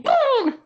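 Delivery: a sudden high, loud "Boum!"; boom. Acted. Mother play-fighting with her son, loud and playful.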